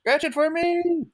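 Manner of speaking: very high, drawn-out pleading voice; old lady customer. Retold or imitated. Old lady begging clerk for help, high wheedle.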